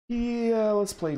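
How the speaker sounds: excited. Real, not acted. Eager card play.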